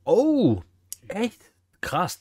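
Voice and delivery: usual speaking voice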